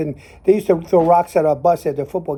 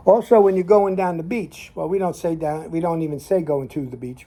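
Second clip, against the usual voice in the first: ordinary talk, nothing marked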